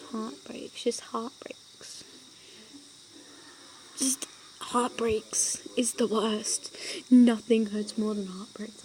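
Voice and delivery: hushed, sad whisper. Real, not acted. Hushed whisper to viewers about heartbreak pain. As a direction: breathe the words out softly.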